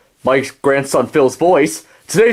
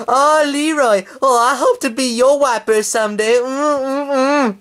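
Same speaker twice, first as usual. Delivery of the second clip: put-on much higher dreamy girlish voice; imagined girlfriend voice; 9 semitones higher than usual. Leroy imagining Henrietta's reply, much higher cooing voice. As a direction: coo it like a smitten hen. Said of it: "I hope she says".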